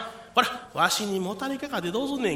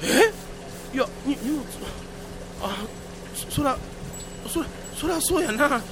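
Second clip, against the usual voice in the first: stunned stammer; 4 semitones higher than usual. Acted. Realizes it was not Takiyan.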